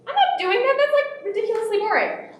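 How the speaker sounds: high-pitched mock little-kid protest; whiny kid refusal. Six-year-old son refusing his father's orders, whiny high voice. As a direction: put on a bored child's high voice.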